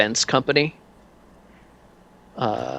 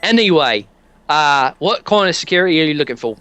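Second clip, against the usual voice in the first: light British shopkeeper voice; British accent; 3 semitones higher than usual. Acted. Sammy Fraser inviting the customer upstairs in a British accent. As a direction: play Sammy as a chummy Brit. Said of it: "can't do this accent very well".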